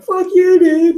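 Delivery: high, loud reenacted yell; acted-out angry shout; raging. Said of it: "Got into a whole big rage".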